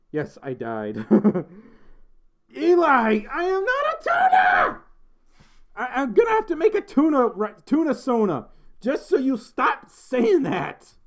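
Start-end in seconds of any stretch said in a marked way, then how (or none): 2.5-4.8 very high, loud shouted protest; exasperated shout. Real, not acted. Streamer to Eli who keeps teasing, exasperated shout. 5.7-10.9 loud, high-pitched ranting; exasperated outburst. Real, not acted. Streamer fed up with the tuna teasing, exasperated outburst.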